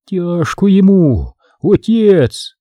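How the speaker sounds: slow, high troubled crone voice; worried old woman. A narrator's reading. Old woman frets to the priest as he stops reading. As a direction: fret over the dead soul.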